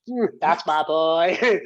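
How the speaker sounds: put-on slow, drawn-out "Mm, that's my boy" with a proud purr; smug player. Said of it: "the player in me".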